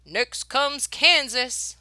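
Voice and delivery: slow, loud announcement; loud, slow call of the next stop; driver calling out. Acted. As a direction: stretch it out like a coachman's call. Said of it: "shouted the driver".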